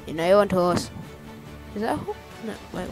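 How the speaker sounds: ordinary delivery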